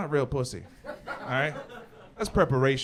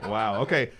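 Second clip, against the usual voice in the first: ordinary delivery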